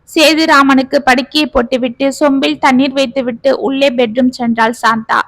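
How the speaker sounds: ordinary delivery, nothing marked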